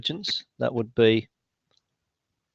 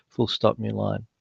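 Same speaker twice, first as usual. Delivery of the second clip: quieter dictation command aside; dictating; 6 dB quieter than usual. Real, not acted. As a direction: drop the voice to dictate punctuation. Dictating punctuation to software.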